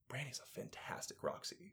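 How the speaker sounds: whispered aside; hushed praise. Real, not acted. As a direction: whisper it like a secret. Confiding aside.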